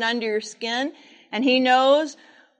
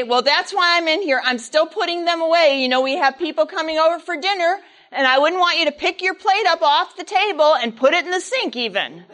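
scolding mother; 6 semitones higher, 4 dB louder than usual. Retold or imitated.

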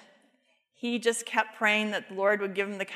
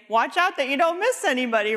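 loud, high, fast mocking warning; louder, higher sarcastic quip; mock sarcastic jab; 5 semitones higher, 5 dB louder, faster than usual. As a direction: toss it off as a sarcastic jab at the driver. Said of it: "Or you could say".

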